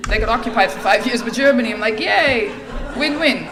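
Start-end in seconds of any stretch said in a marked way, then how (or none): 2.0-3.5 loud mock cheer, dripping irony; a fake-happy yay; sarcastic cheer. Sarcastic cheer about occupation.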